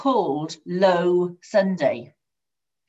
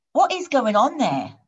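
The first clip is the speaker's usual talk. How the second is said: higher-pitched, insistent question; exasperated boss; 7 semitones higher, 3 dB louder than usual. A narrator's reading. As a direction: demand an explanation. Boss losing patience on phone.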